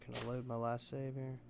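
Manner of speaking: ordinary talk, nothing marked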